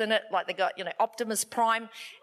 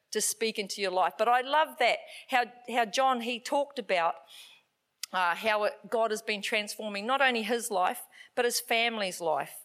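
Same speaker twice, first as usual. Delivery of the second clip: ordinary talk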